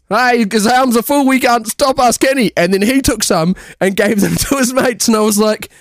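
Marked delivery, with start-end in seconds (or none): 0.1-2.5 high, mocking Aussie pensioner; old lady's mate. Her mate joins in taking chips, mock Aussie voice. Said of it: "And then her mate went".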